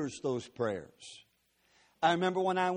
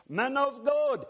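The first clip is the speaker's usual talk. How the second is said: high called-out "Man of God" from far off; distant calling voice; 8 semitones higher, 3 dB louder, slower than usual. Retold or imitated. Stranger calling the preacher across the airport, high and far off. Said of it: "the voice gets a little closer".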